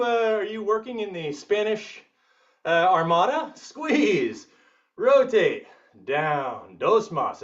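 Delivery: usual speaking voice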